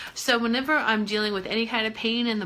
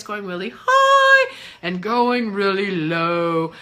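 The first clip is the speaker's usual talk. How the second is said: high and low voice; 3 semitones lower, 6 dB louder, slower than usual. Shown as a demonstration. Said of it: "demonstrates high and low voice".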